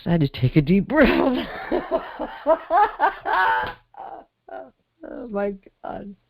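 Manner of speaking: high, real laughter overtaking the words; laughing hard. Real, not acted. Host losing composure on the podcast, laughing hard. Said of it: "I'm laughing so hard".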